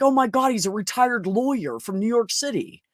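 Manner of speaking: playing his wife's shocked reveal; quoted surprise. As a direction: gasp it out like big news. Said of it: "She's like".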